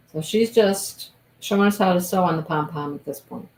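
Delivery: ordinary talk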